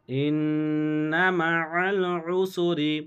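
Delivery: slow chant with a long nasal ন্ন in ইন্না; Quran recitation. Shown as a demonstration. Teacher reciting with the nasal ghunna for students. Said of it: "গুন্না ওয়াজিব".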